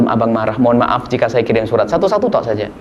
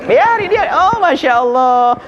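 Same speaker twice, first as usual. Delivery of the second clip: high, slow, drawn-out sleepy replies; half-asleep husband nodding along, stretching every word; husband humoring his wife; 11 semitones higher, much slower than usual. Acted. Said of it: "kayak nyanyi saja". (suami malas menanggapi, suara tinggi dan panjang)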